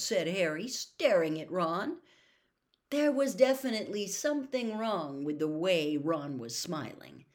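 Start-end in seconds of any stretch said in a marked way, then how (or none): none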